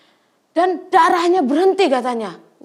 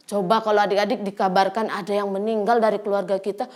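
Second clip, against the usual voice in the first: usual speaking voice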